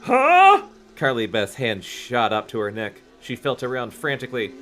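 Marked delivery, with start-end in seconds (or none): 0.0-0.6 very high, loud startled yelp; shocked squeak. Acted. Carly Beth startled.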